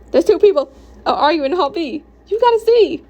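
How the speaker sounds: high, loud excited gossip; excited urging. Acted. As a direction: urge a friend to come see, voice climbing high. Excited friend reporting a hallway fight.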